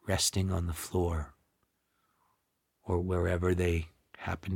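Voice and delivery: guided meditation. Acted. Teacher guiding listeners to feel hands, feet and breath, guided meditation. Leads a body-sensing meditation.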